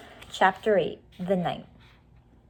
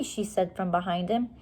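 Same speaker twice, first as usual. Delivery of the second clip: ordinary delivery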